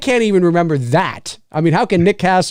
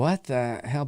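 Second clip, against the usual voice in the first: puzzled aside; 2 semitones lower, 9 dB quieter, slower than usual. Retold or imitated. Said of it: "it's kinda like".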